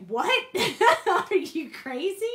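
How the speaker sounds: high, loud disbelieving laugh; incredulous laugh. Retold or imitated. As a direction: scoff at a crazy idea. Mom reacts to a wild plan.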